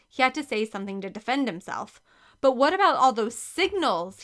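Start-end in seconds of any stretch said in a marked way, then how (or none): 2.4-4.2 louder, desperate blurt. A narrator's reading. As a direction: burst it out before thinking. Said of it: "he blurted out".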